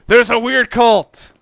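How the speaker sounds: slow, loud, hammed-up announcement; mock excitement. Gamer mocking the story's clichés, loud mock hype. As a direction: sell the cheesy twist like a trailer. Mock hyping B movie plot.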